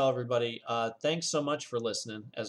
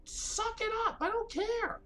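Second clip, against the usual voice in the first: much higher pitched, curt and fed up; retold angry retort; slower than usual. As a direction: brush her complaint off angrily.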